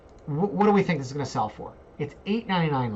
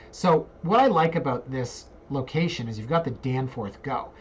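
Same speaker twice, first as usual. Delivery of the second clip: normal talking voice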